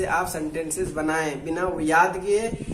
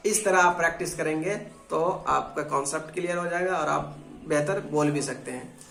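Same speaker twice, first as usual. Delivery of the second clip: usual speaking voice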